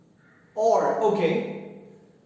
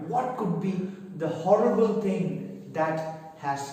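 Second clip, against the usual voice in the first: ordinary talk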